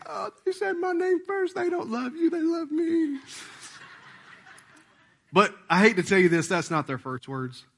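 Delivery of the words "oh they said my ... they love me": much higher mock-gloating voice, like a smug kid; childish gloat, pitched way up; gloating parent. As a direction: tease the other parent, sing-song and smug. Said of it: "you know, like".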